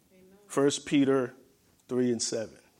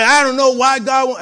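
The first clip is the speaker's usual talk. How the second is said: higher, louder indignant outburst; hostile; 9 semitones higher, 11 dB louder than usual. Retold or imitated. Brother complaining to the preacher, loud and indignant. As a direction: throw the brother's protest up and out. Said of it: "he was hostile with that".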